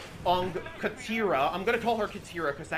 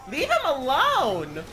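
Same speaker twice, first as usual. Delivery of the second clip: mock Katara; 6 semitones higher, 4 dB louder, much slower than usual. Quoting Katara's line.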